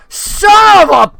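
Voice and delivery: high, loud cursing outburst; the puppet swears at the top of its voice; cursing shout. Acted. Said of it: "starts cursing and swearing".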